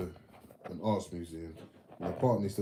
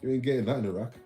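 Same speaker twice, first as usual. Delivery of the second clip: loud, high gloating; laughing punchline; excited punchline; 5 semitones higher, 7 dB louder, faster than usual. Real, not acted. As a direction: deliver the joke with a grin.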